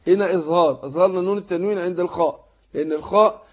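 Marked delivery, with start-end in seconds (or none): none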